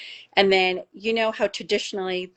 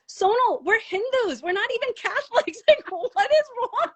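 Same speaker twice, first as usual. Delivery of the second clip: very high imitation of a baffled husband, breaking into laughter; mock disbelief squeezed through laughter; incredulous, laughing; 14 semitones higher than usual. Retold husband's protest, through laughter.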